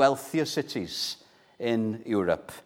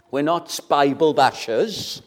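ordinary talk

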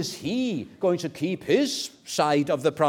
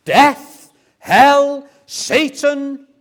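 impassioned declaration; 11 dB louder than usual. Acted. Believer declaring all-out surrender to Jesus, impassioned, swelling to a shout. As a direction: cry it out in triumph, key words punched.

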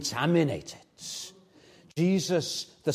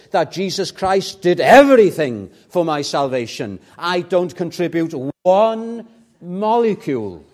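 louder, impassioned pulpit preaching; full-force preaching; 3 semitones higher, 10 dB louder than usual. Real, not acted. Preacher to his congregation on sure hope, impassioned and loud. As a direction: drive the point home with rising passion.

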